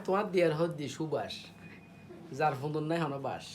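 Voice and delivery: usual speaking voice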